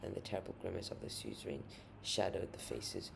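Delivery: ordinary delivery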